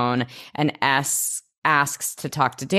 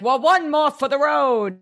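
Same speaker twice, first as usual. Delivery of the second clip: light hammed-up high voice of a guy saying one more; tossing off a cheesy movie line, mock-jaunty; lightly voiced swaggering character; mock cliché voice; 10 semitones higher than usual.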